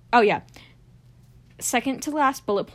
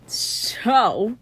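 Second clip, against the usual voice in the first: normal talking voice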